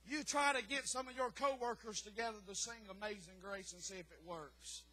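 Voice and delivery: ordinary talk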